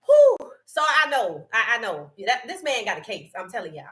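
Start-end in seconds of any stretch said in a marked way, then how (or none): 0.0-0.4 high, loud exhale; high loud whew. Real, not acted. Exclaims after laughing.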